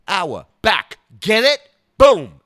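loud, rising "Hour, back, get it?" ending in a big "Boom"; hammed-up shout. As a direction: bellow the punchline at the crowd. Dice hammering his punchline.

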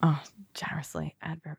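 hushed whisper to herself; whispered aside. Real, not acted. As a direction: whisper the correction under her breath. Quietly corrects own word choice.

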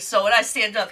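normal talking voice

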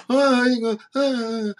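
mock dim-witted drone of a doubter; mocking imitation of naysayers. Mimics what doubters are thinking.

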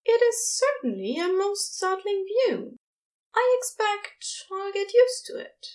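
Aziraphale voice. A narrator's reading.

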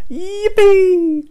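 shrill-high loud whoop of joy; gleeful storybook cheer; excited cry. A narrator's reading. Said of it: "cries Doreen".

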